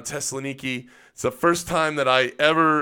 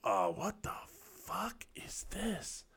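hushed disbelief; 5 semitones higher, 13 dB quieter, slower than usual. Retold or imitated. Young soldier facing a squat toilet, hushed disbelief. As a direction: whisper it slowly, as if stunned. Retells stunned first reaction.

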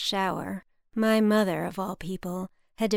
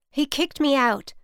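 louder, higher exasperated outburst; 6 semitones higher, 7 dB louder than usual. A narrator's reading. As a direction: hammer it home, fed up. Mother repeats news, exasperated.

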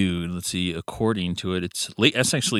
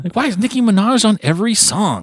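exasperated; 12 semitones higher, 3 dB louder than usual. Retold or imitated. Mock complaint about a featured artist.

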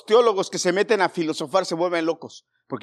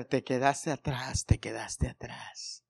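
slow, soft, haunting mock refrain; eerie film refrain; 4 semitones lower, 8 dB quieter, slower than usual. Recalling the Left Behind film's music, haunting. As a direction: croon it like ominous movie music.

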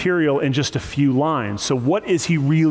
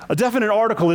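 laughing; 8 semitones higher, 4 dB quieter, much faster than usual. Real, not acted. Laughs at his own mock question.

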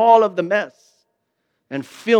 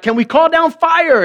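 much higher and louder, eager question; eager disciples; 9 semitones higher, 4 dB louder than usual. Retold or imitated. Disciples asking Jesus for fire, eager and high. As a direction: pitch it like eager disciples itching for a fight. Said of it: "The disciples would be like".

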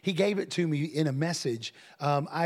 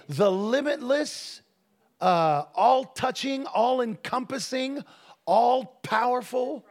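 slow, high, ringing build; drawn-out, raised preacher's cadence; exalting preaching; 5 semitones higher, slower than usual. Real, not acted. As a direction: proclaim each word separately with awe. Preacher piles up exalting words.

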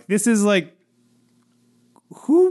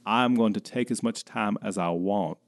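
unhurried, low Charleston drawl; Charleston accent; 5 semitones lower, 6 dB quieter than usual. Shown as a demonstration. Showing that nobody's time is important, leisurely Charleston drawl. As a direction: let every word take its time, Charleston style. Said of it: "main thing about the Charleston accent".

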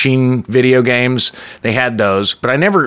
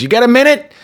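urgent ask; 13 semitones higher, 3 dB louder, faster than usual. Retold or imitated. Tony grabs him on the street.

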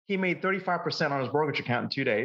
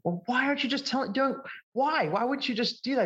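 imitating an exasperated wife, much higher pitch; retold nagging questions, pitched way up; frustrated wife; 12 semitones higher than usual. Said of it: "she was a little bit frustrated with him".

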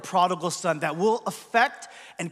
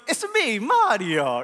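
playful cartoon Italian catchphrase; Mario's Italian accent; slower than usual. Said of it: "wonderful Italian accent".

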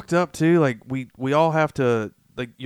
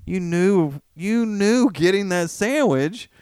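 ordinary delivery

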